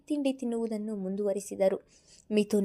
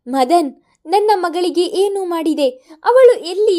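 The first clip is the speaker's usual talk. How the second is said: very loud, much higher furious yell; roaring shout; 8 semitones higher, 12 dB louder than usual. A narrator's reading. Father shouting at the kidnapper, full roar. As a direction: roar it to raise the roof. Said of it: "ಮನೆಯ ಸೂರು ಕಿತ್ತು ಹೋಗುವ ಹಾಗೆ ಕೂಗಿದರು".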